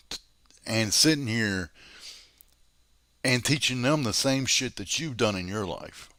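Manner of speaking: ordinary delivery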